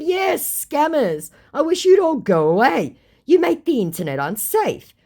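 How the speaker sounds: mock-exasperated rant; retold angry reply. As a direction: vent at all scammers. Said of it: "I said".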